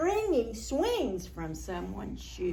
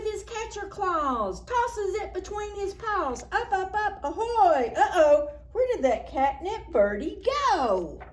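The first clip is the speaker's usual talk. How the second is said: excited; 8 semitones higher, 4 dB louder than usual. A narrator's reading. Lively reading of cat play.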